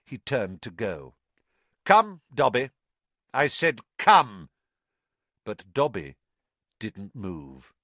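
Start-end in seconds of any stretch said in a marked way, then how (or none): 1.8-4.5 loud sharp order; commanding. Acted. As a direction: bark the command. Master commanding servant.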